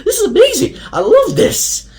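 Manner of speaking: hammed-up awestruck delight; excited gushing. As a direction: gush like a kid who just loved the film. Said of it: "I was like".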